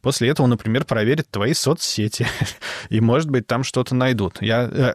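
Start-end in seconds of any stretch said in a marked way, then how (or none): 2.3-2.8 short breathy nervous laugh; a quick uneasy exhale of a laugh. Real, not acted. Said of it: "это нервный смех у меня абсолютно".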